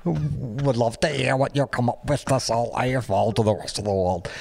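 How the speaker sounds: hammed-up, laughing botched Liverpool accent. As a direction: struggle through a fake Liverpudlian accent. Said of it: "in a terrible Beatles accent".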